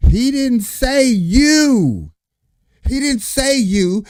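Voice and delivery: high, slow, drawn-out disbelief; exasperated high correction. Real, not acted. Reacting to a clip, high exasperated correction.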